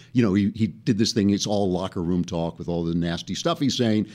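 normal talking voice

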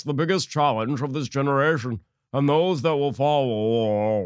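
slow, droning politician caricature; John Kerry. Acted. Host reading out Kerry's tweet, mocking drawn-out impression. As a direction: drag the words out into a long dying drone. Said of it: "Kerry tweeted on Monday".

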